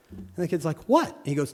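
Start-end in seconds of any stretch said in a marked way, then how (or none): 0.9-1.2 put-on much higher kid voice; puzzled kid. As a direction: ask what in confusion.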